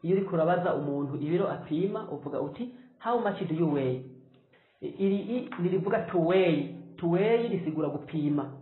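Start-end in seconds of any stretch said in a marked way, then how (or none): none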